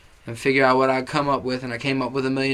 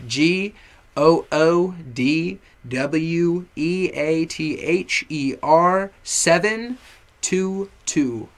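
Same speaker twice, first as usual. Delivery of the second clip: letters spaced out slowly, a bit louder; slow spelling; 3 semitones higher, 4 dB louder, much slower than usual. Shown as a demonstration. Slow spelled-out handle. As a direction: rapper spelling his brand handle for viewers, slow and loud. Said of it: "spelling out the brand handle".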